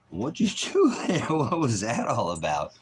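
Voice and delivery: squealed mock surprise; playful astonishment. Real, not acted. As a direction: ask the dog in amused disbelief. Mock-questioning his dog.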